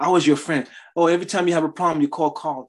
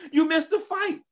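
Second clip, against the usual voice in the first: high, teasing tempter calling out; a mocking devil luring; taunting devil voice; 13 semitones higher than usual.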